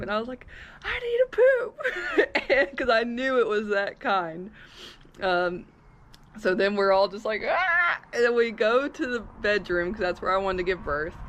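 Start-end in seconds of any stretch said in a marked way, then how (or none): 0.8-2.8 high, slow, stretched-out cry for help; drawn-out yell. Retold or imitated. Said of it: "I yelled my midwife's name". 7.5-8.0 excited yelp. Retold or imitated. Everyone reacts to birth urgency.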